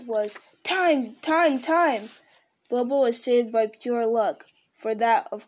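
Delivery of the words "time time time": high, repeated squeal of a word; sudden squeal. A narrator's reading. Bilbo panicking for more time, squealing. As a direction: squeal it out, each word jumping up. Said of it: "came out was a sudden squeal".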